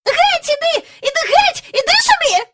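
very high, loud furious shooing; angry shooing. A narrator's reading. Miss Lark furiously shooing the mongrel, high shout. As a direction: drive the dog off angrily. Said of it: "люто махала на пса руками".

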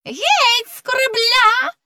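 very high, drawn-out cry; shrieked. Acted. Fox sister orders him off the ship, crying out. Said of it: "закричала лисичка-сестричка".

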